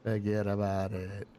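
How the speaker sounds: slow, drawn-out Italian-American catchphrase; Italian-American accent. Pretend. Friend joins in practising the catchphrase, slow Italian-American accent. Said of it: "working on my Italian accent".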